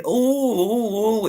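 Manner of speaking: hammed-up theatrical oh oh; mocking a dramatic fake wail. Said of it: "It's not me going".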